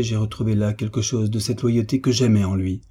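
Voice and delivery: ordinary delivery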